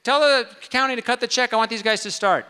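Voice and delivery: calling out. Retold or imitated. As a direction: call out to an assistant, brisk and carrying. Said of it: "calls out to her".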